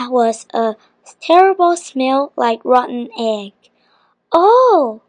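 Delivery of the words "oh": high, falling "oh" of shock; a mildly horrified exclamation; gasped. A narrator's reading. Biff sees the genie escape, gasping. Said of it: "gasped Biff".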